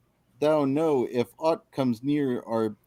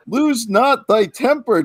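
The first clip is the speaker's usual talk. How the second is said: laughing; 11 semitones higher, 8 dB louder than usual. Real, not acted. Still laughing, calming Wookiee.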